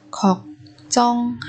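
걱정하다 with gaps between syllables. Shown as a demonstration. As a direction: separate every syllable of 걱정하다 for learners. Teacher models word syllable by syllable.

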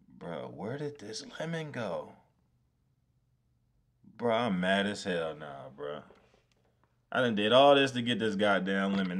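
ordinary delivery

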